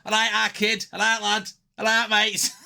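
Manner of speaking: put-on "all right, our kid" in a chirpy Manchester accent; Mancunian lad voice. Commentator imitating [name] in a Manchester lad accent. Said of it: "He's like, he's like".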